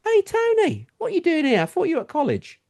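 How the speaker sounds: bright high call of recognition; surprised greeting. Retold or imitated. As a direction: hail him as someone you know, pitched well up. Another couple greets him.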